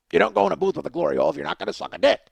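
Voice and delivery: gruff, rapid-fire mock yelling; Oscar the Grouch. Stranger yelling at him through the wall, grouchy. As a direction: bark it like a furious grumpy puppet. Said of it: "like, yelling at me".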